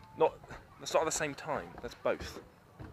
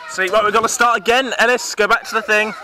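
raised, higher, loud call; calling out instructions; 7 semitones higher, 10 dB louder than usual. Real, not acted. Telling Ellis to go back in the game, calling out. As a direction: call it out to send them back.

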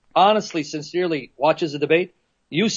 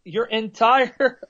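normal talking voice

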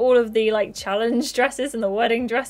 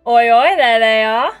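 loud, cheeky call-out; loud greeting; 8 dB louder than usual. Acted. Girls spot the waiting boys, loud call-out. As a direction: call out to the boys across the lawn. Character calls out to group.

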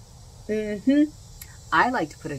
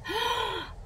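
gasp; 5 dB quieter than usual. Real, not acted. Reveals gorgeous board.

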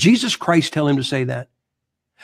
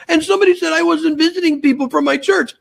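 very high mock whine; whining; 11 semitones higher than usual. Mimicking a pastor complaining about his critics, mock whine. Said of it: "What a whiner".

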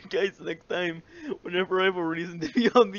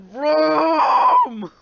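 mock shout; 8 semitones higher, 9 dB louder than usual. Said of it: "The fish said".

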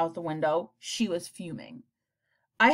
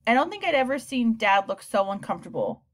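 usual speaking voice

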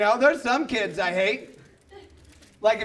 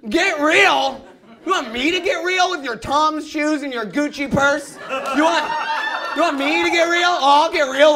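raised, incredulous retort; indignant rant; 3 semitones higher than usual. Acted. Skater to a kid's mom, indignant. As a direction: throw the words back at her.

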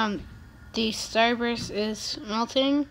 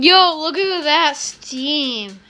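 excited; 4 semitones higher, 7 dB louder, slower than usual. Real, not acted. Amazed at the steam.

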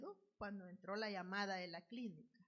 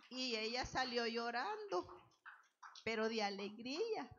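louder, higher, building excitement; excited climax; 4 semitones higher, 8 dB louder than usual. Real, not acted. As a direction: lift it up with joyful fervour. Testimony climax, crying with joy. (emocionada, más fuerte y más aguda)

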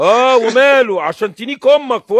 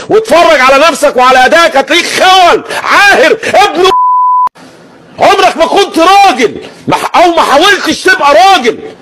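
angry; 5 semitones higher, 7 dB louder than usual. Real, not acted. Furious insult tirade.

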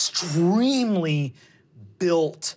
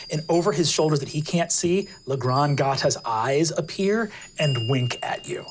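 ordinary talk

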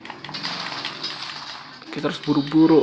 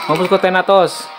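battle taunt shout; 6 semitones higher, 5 dB louder, faster than usual. Acted.

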